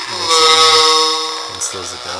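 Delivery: slowed, echoing evil voice; evil voice effect. Shown as a demonstration. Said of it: "So it just has echo to it".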